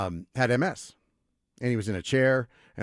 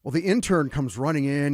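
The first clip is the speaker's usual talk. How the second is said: normal talking voice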